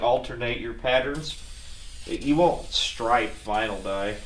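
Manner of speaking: ordinary delivery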